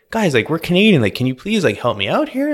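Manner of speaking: retold plea, mildly worked up; emotional pleading. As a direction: imitate someone appealing emotionally for help. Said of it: "she started getting, like, really emotional".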